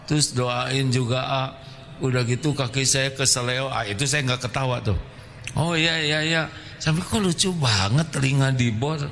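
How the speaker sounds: usual speaking voice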